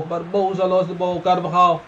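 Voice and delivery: ordinary delivery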